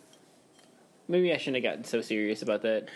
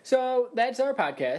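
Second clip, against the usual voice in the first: abrupt sign-off; 10 semitones higher, slower than usual. Acted. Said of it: "took a deep breath and said".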